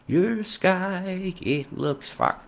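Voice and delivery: high squawky duck voice, straining; a failed try at a cartoon duck; high pitched duck impression, strained. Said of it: "I can't do the duck voice".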